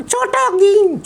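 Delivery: put-on high, slow child puppet voice; puppet boy voice. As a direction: play the cheeky little boy puppet. Puppet names hero.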